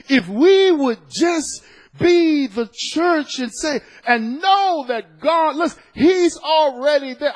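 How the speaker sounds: high, loud, slow preaching cry; preaching at full force. Real, not acted. Pastor exhorting his congregation, full-force slow preaching. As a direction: stretch every word out to the church.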